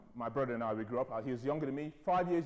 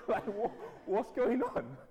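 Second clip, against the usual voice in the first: put-on Ghanaian accent; 8 semitones higher than usual. Poor guy waking from nightmare, baffled Ghanaian accent. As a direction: play a baffled Ghanaian, voice jumping up. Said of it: "if he was Ghanaian".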